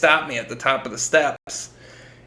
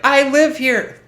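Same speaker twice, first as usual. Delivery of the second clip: high, loud frightened squeak; boy answering the cop in fright; scared kid; 13 semitones higher, 8 dB louder, slower than usual. Retold or imitated. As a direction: squeak it out terrified. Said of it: "I was like".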